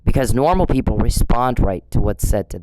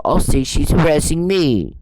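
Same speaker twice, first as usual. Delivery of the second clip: high mocking crybaby whine; mocking a harasser as a whiny toddler; whiny baby mockery; 5 semitones higher than usual. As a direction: mimic him as a big baby. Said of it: "when she complains about it".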